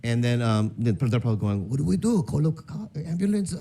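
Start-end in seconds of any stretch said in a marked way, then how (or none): 1.7-3.5 imitating panicked relatives, high; mock panic. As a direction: ask frantically what to do.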